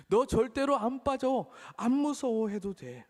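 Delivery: high, slow, coaxing dad voice; reassuring father. Acted. Father holding his child in the pool, high slow reassurance. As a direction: soothe a scared child in the water.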